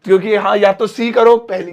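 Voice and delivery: ordinary delivery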